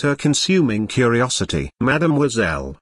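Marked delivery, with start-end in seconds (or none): none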